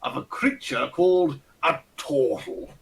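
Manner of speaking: lightly voiced pompous old aristocrat; a hint of a Ronnie Barker toff; pompous old lord. Fat old lord greeting the party, lightly pompous and fussy. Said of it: "with the accent and everything".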